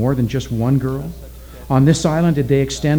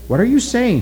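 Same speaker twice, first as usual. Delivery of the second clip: high-pitched incredulous question; 10 semitones higher than usual. A narrator's reading. Witch doctor challenges Oki's protest, questioning. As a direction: ask in disbelief, pitch up. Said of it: "he asked".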